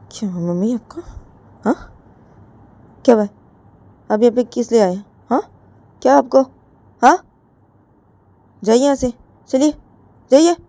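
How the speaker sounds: rude dismissal. Acted. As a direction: shoo her mother out. Jiya tells mother to leave.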